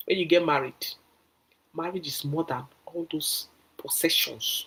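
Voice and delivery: ordinary talk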